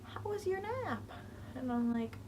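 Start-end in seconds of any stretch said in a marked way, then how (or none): 0.0-1.0 high mock-sweet teasing voice; lightly voiced teasing kid from the back of the bus; teasing classmate. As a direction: needle her like a smug bus-mate. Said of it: "someone from the back of the bus was like".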